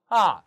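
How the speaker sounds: 아 falling sharply from high; fourth tone. Shown as a demonstration. Teacher demonstrating the fourth tone of 아, falling. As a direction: snap 아 down hard from the top. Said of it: "사성".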